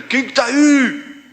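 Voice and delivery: high, drawn-out grandfatherly holler; calling out. Retold or imitated. Suspicious grandfather calling to whoever is in the kitchen, shouting. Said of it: "Aí ele gritava de lá".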